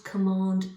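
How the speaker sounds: "command" with a loud, hard k; "command" spat out on its hard k; hard C stressed. Shown as a demonstration. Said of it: "that hard C sound".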